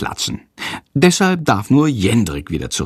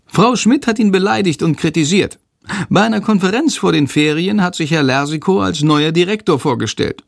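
higher, quick creature voice; Shandor's voice; 7 semitones higher than usual. Acted. Shandor tells Jendrik school gossip, eager. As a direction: tell the gossip in the little creature's voice.

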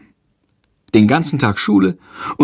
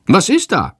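startled question; 8 semitones higher, 3 dB louder than usual. A narrator's reading. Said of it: "fragt Lersiko".

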